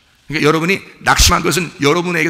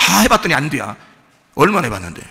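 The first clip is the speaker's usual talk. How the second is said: ordinary delivery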